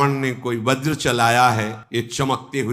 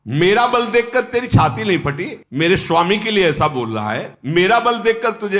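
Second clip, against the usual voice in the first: high-pitched acted outrage; indignant challenge; 7 semitones higher than usual. Angad confronting Ravan for insulting his master, indignant challenge. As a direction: challenge him with mild fury.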